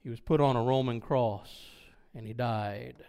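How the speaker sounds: low, grave and hushed; solemn. Real, not acted. Preacher tells the congregation of the death on the cross, solemn.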